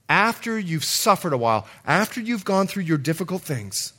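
ordinary delivery

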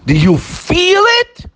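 high, drawn-out probing question; pointed challenge. Real, not acted. Preacher confronts listeners about whether they feel it, challenging.

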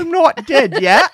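high, loud, drawn-out comic queen; elderly queen impression. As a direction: protest slowly in a put-on royal falsetto.

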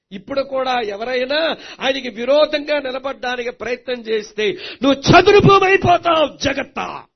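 loud preaching climbing to a shout; thundering warning. Real, not acted. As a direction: warn the crowd at full force. Preaching at full force.

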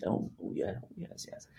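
imitating a tiny whisper; tiny whispered yes. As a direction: mimic her barely-there whispered yes. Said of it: "And she's".

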